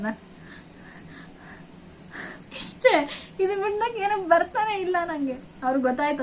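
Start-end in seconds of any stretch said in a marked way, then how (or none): 2.6-5.3 choked, tearful and higher; words breaking through sobs; reliving the breathless panic in tears; crying, gasping. Real, not acted. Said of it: "ಎಲ್ಲಿ ಮಾತ್ ಬರ್ಬೇಕಲ್ವಾ".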